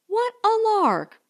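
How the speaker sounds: high, gushing squeal of fun; thrilled by the silly adventure; girlish excited gush; giddy delight. Acted. Girls thrilled at dishwashing idea.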